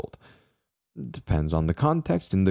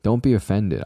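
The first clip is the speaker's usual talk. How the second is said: usual speaking voice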